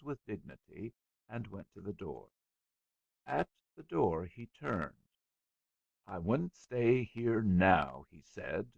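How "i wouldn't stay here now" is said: slow, cold, disdainful words; slow contempt. A narrator's reading. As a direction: let each word drip with scorn. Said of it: "with slow contempt".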